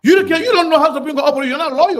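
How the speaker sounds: loud, high and fast, shouting him down; angry outburst. Real, not acted. Host shouting at the guest who dismissed his point, loud angry retort. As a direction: snap back hard, fired up and indignant.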